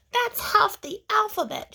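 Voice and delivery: put-on high, know-it-all kid voice; snooty classmate. Victoria tattling to the teacher, high and smug.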